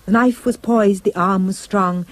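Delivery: usual speaking voice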